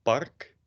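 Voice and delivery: "park" with no puff of air on the p; unaspirated "park", Dutch style. Shown as a demonstration. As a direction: say "park" with a tight, airless Dutch P. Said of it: "whereas in Dutch you say".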